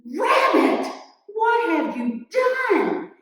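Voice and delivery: high, loud, drawn-out accusation; outraged scolding. Acted. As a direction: confront the culprit in loud, shocked outrage. Bear exposes and scolds Rabbit.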